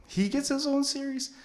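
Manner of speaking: high, rising disbelief; surprised question. Retold or imitated. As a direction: sound amazed he got a show. His surprise at new show.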